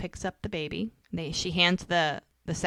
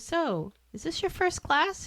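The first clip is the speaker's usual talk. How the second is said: higher, lightly voiced woman's reply; voicing the female teacher; 12 semitones higher than usual. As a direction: mimic her breezy self-explanation, pitched up.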